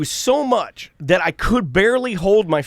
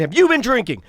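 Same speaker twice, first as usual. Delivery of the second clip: high, pointed question; retold angry demand; 7 semitones higher than usual. Asking the driver about drinking, retold angry demand. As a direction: re-enact a first insistent accusation. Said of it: "And I'm yelling".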